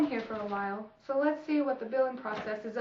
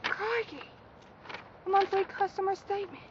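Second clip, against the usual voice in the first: excited; 9 semitones higher than usual. Acted. Wildlife host marvels at find.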